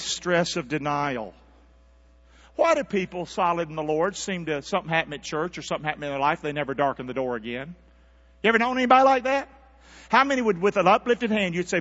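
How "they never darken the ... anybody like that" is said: ordinary talk, nothing marked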